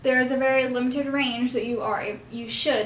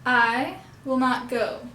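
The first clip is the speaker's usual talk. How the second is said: slow "I will not go", leaning only on "I" and "go"; slow stress on I and GO; slower than usual. Shown as a demonstration. Students try the puppet phrase again, slow with stress on I and go. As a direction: say it slowly, opening only on "I" and "go". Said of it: "Try that again".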